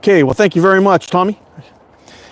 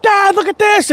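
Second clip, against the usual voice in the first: put-on much higher, a young boy shouting with joy; excited kid. Dad imitating his son showing off his fish, excited and high. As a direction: squeal it like a thrilled kid. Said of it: "He's like".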